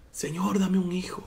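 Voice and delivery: breathy whisper, barely audible; whispered prayer. Retold or imitated. Preacher voicing Ana's silent plea to God, whispered. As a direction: pray it with only your lips moving. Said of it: "solo movía sus labios".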